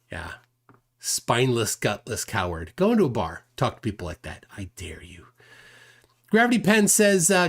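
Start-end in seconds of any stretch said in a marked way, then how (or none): 3.5-5.3 unvoiced taunting whisper; whispered dare. Real, not acted. Streamer taunting Nathan through the camera, whispered dare. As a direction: breathe the challenge, no voice.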